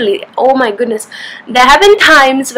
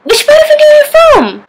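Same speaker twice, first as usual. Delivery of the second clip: much higher, excited asker; eager question. Acted. Local quizzing an Indian visitor, high and eager. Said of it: "acted dialogue as local".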